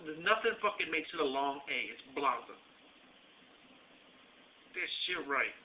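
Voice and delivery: ordinary talk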